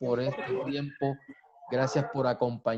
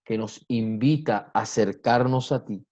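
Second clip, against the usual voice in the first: prayer; 4 semitones lower than usual. Acted. Pastor prays aloud to God.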